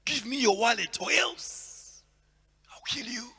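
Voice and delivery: loud, slow threatening mugger; mugger threat. Retold or imitated. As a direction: bark it like a street robber. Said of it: "this guy's like".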